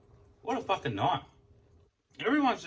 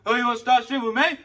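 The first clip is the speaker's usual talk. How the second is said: confrontational attendant; 10 semitones higher, 9 dB louder than usual. Retold or imitated. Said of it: "He was like".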